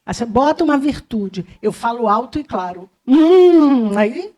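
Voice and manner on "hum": ordinary delivery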